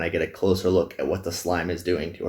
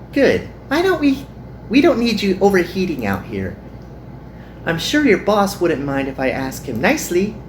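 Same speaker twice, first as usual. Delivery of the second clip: put-on higher, bright concerned girl's voice; cheerful Hikari; 11 semitones higher than usual. Girl finding her friend in the heat, higher and caring. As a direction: ask kindly with a lift.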